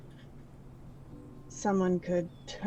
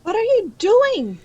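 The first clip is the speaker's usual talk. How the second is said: upset loud shout; 13 semitones higher, 11 dB louder than usual. Acted. Figure protests the shot at wolves.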